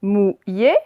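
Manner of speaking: "mouillé" split into mou, illé; syllable by syllable. Shown as a demonstration. Teacher reading words aloud to pupils, syllable by syllable. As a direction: sound out "mouillé" in two separate beats. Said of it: "on va faire les deux d'un coup".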